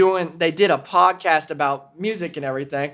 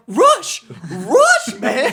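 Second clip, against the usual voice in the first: much higher, loud squeal of excitement; 14 semitones higher, 5 dB louder, much slower than usual. Retold or imitated. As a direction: squeal the name like a giddy fan. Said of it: "and I was like".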